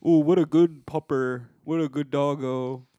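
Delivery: slow cooing voice for a good dog; doggy baby talk. Retold or imitated. Owner cooing praise at a dog, slow and sing-song. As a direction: coo at the pup like a pet owner.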